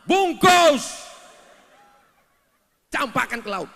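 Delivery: high, loud barked order; mock furious wife; angry mother command. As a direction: snap the order at the son. Mother ordering son angrily.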